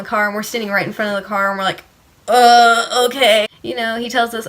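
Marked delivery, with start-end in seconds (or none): 2.3-3.5 loud, drawn-out awkward okay; hesitant okay. Retold or imitated. Teens reacting to the cop turning lights on, acted hesitant. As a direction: retell a put-on awkward reaction. Retelling their baffled response to cop.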